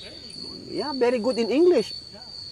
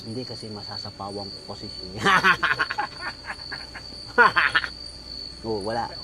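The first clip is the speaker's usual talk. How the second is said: mic handed over; 11 semitones lower, 7 dB quieter, faster than usual. Real, not acted. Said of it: "you're so loud".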